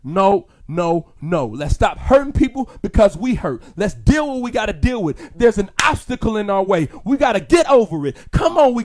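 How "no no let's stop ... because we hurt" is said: ordinary talk, nothing marked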